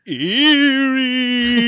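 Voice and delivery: high, loud ghostly wail; spooky voice. Acted. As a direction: moan it like a ghost. Punning in spooky voice.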